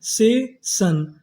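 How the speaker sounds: Session split slowly as से, शन; syllable-by-syllable. Shown as a demonstration. Teacher building session for students, slow and split. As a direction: stretch both parts of session with a gap.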